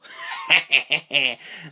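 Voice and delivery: villainous cackle; evil laugh. Acted. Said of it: "Mine is an evil laugh".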